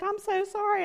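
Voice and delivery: high, apologetic doctor voice; sorry on his behalf. Retold or imitated. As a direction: cardiologist apologizing to the receptionist, high.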